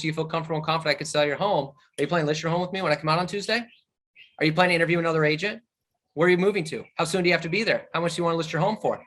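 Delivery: fast, flat, rapid-fire questions; interrogative rattle. Shown as a demonstration. Agent to seller, rattling off rapid interrogating questions. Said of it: "That's interrogative".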